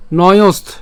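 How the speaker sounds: "নয়স্থ" loud, closing on st; ending on t. Shown as a demonstration. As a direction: model "নয়স্থ" firmly with a final t. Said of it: "ত পর্যন্ত যদি উচ্চারণ করি".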